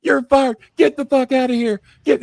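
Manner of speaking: loud, high-pitched firing tirade; high, forceful mock yell; retold screaming. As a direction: imitate a boss screaming someone out. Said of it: "yelling and screaming at me".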